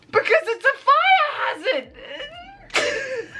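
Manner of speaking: very high, drawn-out exasperated squeal. Retold or imitated. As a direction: stretch it out in high, laughing disbelief. Exasperated retort to clueless landlords.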